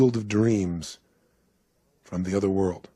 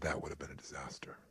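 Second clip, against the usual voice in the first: very low, near whisper; hushed conclusion; 8 semitones lower, 18 dB quieter, faster than usual. Retold or imitated. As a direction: let it sink almost to a whisper. Graham's hushed conclusion, retold.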